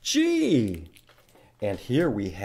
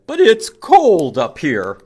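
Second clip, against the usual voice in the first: much louder, complaining of the cold; loud chilly groundhog; 5 semitones higher, 11 dB louder than usual. Acted. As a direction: call out that it's freezing up here. Groundhog voiced in story.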